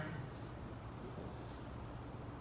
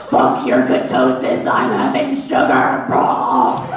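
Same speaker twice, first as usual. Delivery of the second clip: growling monster voice; 8 semitones lower, 10 dB louder, slower than usual. Pretend. Defiant cookie rant.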